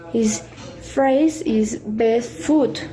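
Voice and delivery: slow, drawn-out demo with long words; stretched-out words. Shown as a demonstration. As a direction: stretch each word out slowly. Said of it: "a little bit longer".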